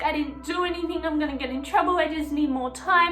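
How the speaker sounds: high-pitched imitation of tearful pleading; mock sobbing whine. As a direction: whinge and plead, imitating someone crying. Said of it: "Dee Dee is crying, she's whinging, she's sooking, she's sobbing".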